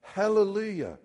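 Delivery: Hallelujah cried out high and loud; shouted hallelujah. Acted.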